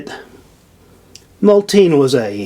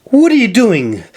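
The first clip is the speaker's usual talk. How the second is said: angry demand; 9 semitones higher than usual. A narrator's reading. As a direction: confront him with the question. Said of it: "she demanded".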